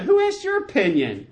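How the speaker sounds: high-pitched, exasperated comeback; mock indignant retort. Preacher replays David's rebuke to Abishai, mock indignant retort.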